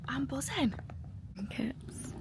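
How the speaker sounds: thrilled whisper; excited whisper. Real, not acted. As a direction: keep it secret but giddy. Hushed in a near-empty cinema.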